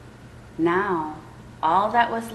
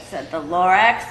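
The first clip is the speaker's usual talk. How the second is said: plain reading voice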